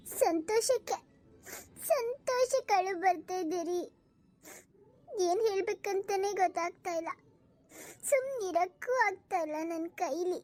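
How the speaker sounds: slow, teary voice, crying from happiness; wife overwhelmed with joy, tearful to her husband. Acted. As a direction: speak through happy tears. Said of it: "ಯಾಕೆ ಅಳ್ತಿದ್ಯಾ?".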